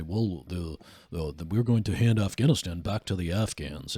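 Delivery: a hint of a put-on deep, earnest politician voice; mock Harvard bro voice. Host mocking how Harvard bro politicians talk, lightly faked deep voice. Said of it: "fake deep voice".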